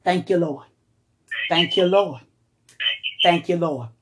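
low hushed thanks; quiet worship. Real, not acted. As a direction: murmur thanks softly to God.

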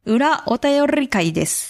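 裏お便り会です said slowly with trilled r on ら and り; rolled r. Shown as a demonstration. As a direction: trill every r in 裏お便り会です, slow. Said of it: "綺麗".

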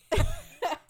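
squealing laugh, high and loud; laughing at the matching note; high burst of laughter. Real, not acted.

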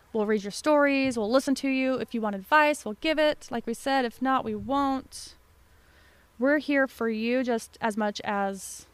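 usual speaking voice

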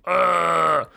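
a little pathetic yelp into the pillow; pathetic whimper scream. Retold or imitated. Lying in bed under the covers, a mock pathetic whimper. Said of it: "Screaming like little pathetic whimpers".

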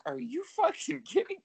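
high, squeaky disbelieving quote; mock outrage, voice shooting up; high incredulous are you fucking kidding. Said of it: "I was like".

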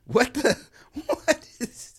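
high-pitched laughing disbelief; surprised laughing. Real, not acted. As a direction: blurt it out mid-laugh. Reacting to the pie's name.

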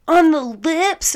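high, loud, stretched-out mock astonishment; incredulous question. Man questioning her about kissing friends, incredulous. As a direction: imitate him aghast, dragging the words out.

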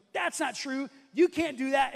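imitating an indignant confrontation; accusing challenge. Soldier confronting the impostor.

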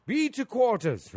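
put-on a high, loud, slow shout in an English accent; screamed order. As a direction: bellow the order to the sleeping crew. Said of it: "Turns around and scream".

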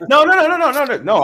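high laughing denial. Real, not acted. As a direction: laugh while waving it off.

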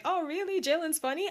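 high, mock-amazed question; surprised disbelief. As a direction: act amazed at the news. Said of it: "I was in there like".

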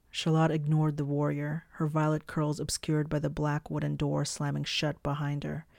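ordinary delivery

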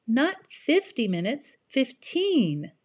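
"Not" and "fifteen" stressed, slow correction; contrastive stress. Shown as a demonstration. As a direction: correct him, hit "fifteen".

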